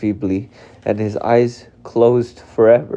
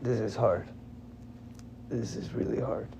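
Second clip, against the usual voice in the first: much softer, exhausted murmur; drained and quiet; 11 dB quieter than usual. Real, not acted. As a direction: barely get the words out. Drained after crying.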